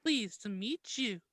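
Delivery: high, slow and sweet; a shy little girl's greeting; sweetly. Acted. Daughter meets Harry, sweet shy high voice. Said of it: "Hermione responded sweetly".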